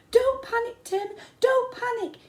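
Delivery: high, loud yell. A narrator's reading. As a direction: yell down anxiously. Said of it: "Kelly yelled".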